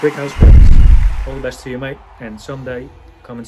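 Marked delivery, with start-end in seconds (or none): 0.0-1.3 excited shout. Pretend. Announcing the twelve points winner.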